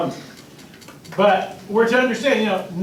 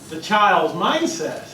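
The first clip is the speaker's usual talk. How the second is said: ordinary talk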